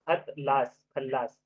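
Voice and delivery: normal talking voice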